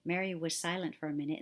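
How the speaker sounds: plain reading voice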